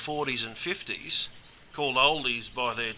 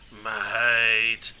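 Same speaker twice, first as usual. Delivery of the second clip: "Mate" held long on a lower pitch; drawn-out "Mate"; 4 semitones lower than usual. Shown as a demonstration. Greeting a close mate with one word, long drawn-out "Mate". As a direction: stretch "Mate" into a whole greeting by itself.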